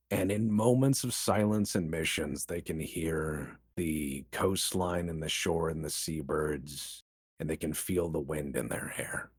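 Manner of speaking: low, hushed storytelling; wistful reverie. Real, not acted. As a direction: soften right down into a tranquil daydream. Imagining a pilot's peaceful escape.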